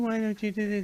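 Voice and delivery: high, pleading mock whine like a spoiled child; whiny little baby. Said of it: "a whiny little baby".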